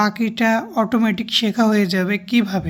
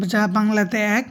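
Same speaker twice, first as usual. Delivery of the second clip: usual speaking voice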